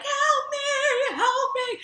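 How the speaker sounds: high, loud retold scream; screaming for help. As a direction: shriek it out as if stuck and helpless. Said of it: "like, screaming".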